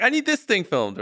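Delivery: high mock whine of a demand; needy channel voice. A channel asking the boss for filming, high mock whine. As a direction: squeak the request like an impatient colleague. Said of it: "this channel's like".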